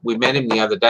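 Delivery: usual speaking voice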